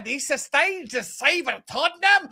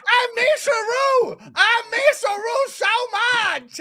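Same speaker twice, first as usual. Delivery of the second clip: gushing shout; 9 semitones higher, slower than usual. Acted. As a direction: wail it out like a lovesick teammate. Gushing to Harry.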